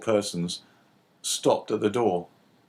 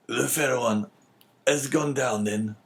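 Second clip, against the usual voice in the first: usual speaking voice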